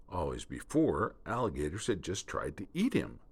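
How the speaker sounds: plain reading voice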